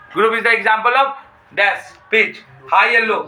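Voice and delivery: slow, deliberate read of the exam question. Shown as a demonstration. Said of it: "एक number में question पूछ देता है".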